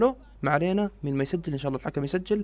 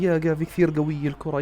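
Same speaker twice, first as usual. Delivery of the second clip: ordinary talk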